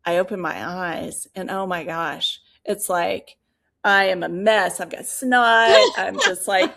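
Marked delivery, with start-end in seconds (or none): 4.8-6.7 real laughter breaking into the words, higher and louder; laughing through it. Real, not acted. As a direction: laugh at the memory of sobbing. Laughing while describing being a mess.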